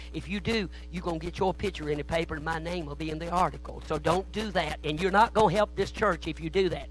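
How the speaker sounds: ordinary talk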